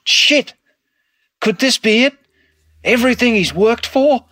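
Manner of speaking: dad's story climbing into high mock horror; dad recounting a nightmare about his son's film, rising to high-pitched mock dread; dad telling his dream. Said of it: "He goes".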